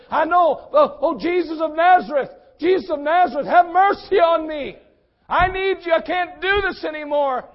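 high, loud, pleading cry; desperate plea. Acted. Convicted beggar crying out to Jesus, high and loud. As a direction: beg as if nothing else is left. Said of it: "almost as if".

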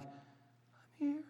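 high, faint and far-off; barely audible, a buried child calling; very weak voice. Retold or imitated. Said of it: "a very weak voice saying".

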